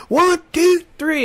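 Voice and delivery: high, loud count-off; rallying countdown. Retold or imitated. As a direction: count everyone in for a big push. Retold count before pushing snowball.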